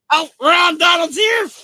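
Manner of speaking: high, loud mock welcome; excited announcing. Streamer hailing a friend joining the call, high and loud. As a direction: announce him like a celebrity.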